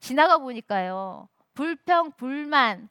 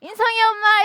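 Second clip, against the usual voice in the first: 높고 크게 부르는 흉내; 듣기 싫었던 호칭을 과장해 외치듯; mock call; 10 semitones higher, 9 dB louder than usual. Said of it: "이러면 너무 듣기 싫은 거예요". (인성이 엄마 부르는 소리 흉내)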